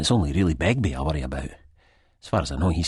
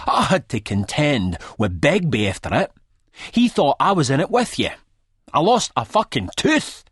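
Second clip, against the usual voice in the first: much higher, louder, rising fury; spitting rant; 10 semitones higher, 5 dB louder than usual. A narrator's reading. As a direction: spit out the grievance, building up. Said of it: "he spits".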